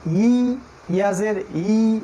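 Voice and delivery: "ইয়াজের ই" drawn out very slowly, long ee. Shown as a demonstration. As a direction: stretch the "ই" long. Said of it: "এইভাবে sound আনতে হবে". ("ই" দীর্ঘ টেনে ধীরে)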